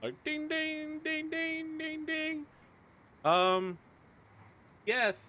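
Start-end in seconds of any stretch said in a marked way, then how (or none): 0.2-2.4 high, soft ringing dings; imitated naval bell. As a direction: chime like an alarm bell in the distance. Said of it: "someone starts ringing, like, a naval bell".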